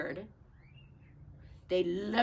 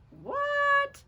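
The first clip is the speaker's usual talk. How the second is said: very high squawked what; parrot squawk; 7 dB louder than usual. Acted. Parrot squawking at Pete, very high. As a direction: screech the word like a bird.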